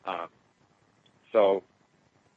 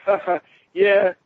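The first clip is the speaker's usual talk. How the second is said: raised, breezy retold dismissal after a fake ha-ha; mock laughing; 6 semitones higher, 4 dB louder than usual. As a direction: imitate a guide laughing it off. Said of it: "like, laughing. She just laughed".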